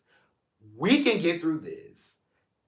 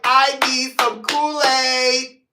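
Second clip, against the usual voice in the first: drawn-out plea; 7 semitones higher, 5 dB louder, much slower than usual. Retold or imitated. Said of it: "I'm like".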